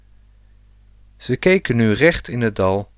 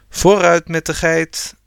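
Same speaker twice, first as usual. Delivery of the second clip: loud, higher rallying call; 6 semitones higher, 4 dB louder than usual. A narrator's reading. Rob rallies the family to move on, calling loud. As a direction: call out to get everyone moving. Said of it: "riep Rob".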